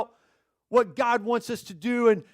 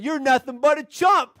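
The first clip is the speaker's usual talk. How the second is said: higher, louder parrot taunt; talking parrot; 5 semitones higher, 5 dB louder than usual. Acted. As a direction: jeer like an insolent parrot. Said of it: "it was that bird".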